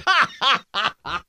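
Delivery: loud, high belly laugh; real, hearty cackling laugh; big laugh. Real, not acted. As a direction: burst out laughing. Laughing at own nickname joke.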